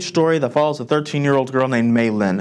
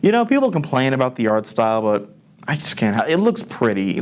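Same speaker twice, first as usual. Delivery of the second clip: ordinary talk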